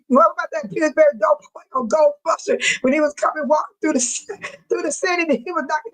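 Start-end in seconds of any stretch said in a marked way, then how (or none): none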